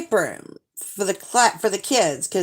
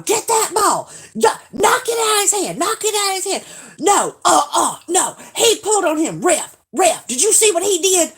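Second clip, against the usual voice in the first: mom yelling at the TV; 10 semitones higher than usual. Retold or imitated. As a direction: imitate mom screaming at the game. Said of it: "can hear her screaming at them".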